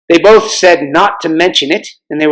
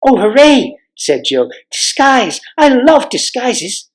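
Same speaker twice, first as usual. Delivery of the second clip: put-on excited girl; 8 semitones higher, slower than usual. Girl delighted at disguises.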